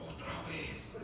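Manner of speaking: low, soft, weary jailer; carcelero resignado. Acted. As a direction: say it low and under your breath. Carcelero recibe otra vez a Pablo. (carcelero resignado, en voz baja)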